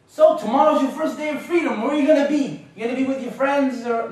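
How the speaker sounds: high, pressing mom questions; mock interrogation. Mother quizzing her son on his first day of freedom, mock interrogation.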